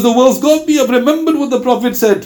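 acted high-pitched anguish; tearful outcry. Retelling why the companion burst into tears, high anguished lament. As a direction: lament it high and urgently, as if overwhelmed. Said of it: "he burst into tears".

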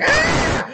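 very high, loud acted shriek; hammed-up frightened "aah"; panicked scream. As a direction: scream like a terrified woman. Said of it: "like, shouting".

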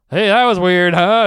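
calling to the room. Retold or imitated. Comic re-enacts addressing the room.

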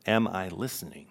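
unhurried questions with room to sit with them; slow reflective reading. Real, not acted. Pastor giving listeners a moment with each question, read slowly. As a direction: read each question slowly, leaving long silences after. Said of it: "just gonna read them slowly".

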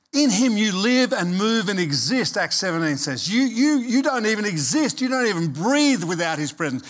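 full-force preaching. Acted. Preacher urging the congregation that God still has a plan, at full force.

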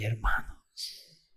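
slow, hushed whisper; whispered hermanos. Real, not acted. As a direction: draw them in with a breath. Sudden hushed address. (susurro lento e íntimo)

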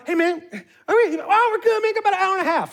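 high, light imitation of an easygoing friend; carefree friend still unbothered; mock carefree. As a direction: keep it breezy though time runs out. Anxious check then friend's answer.

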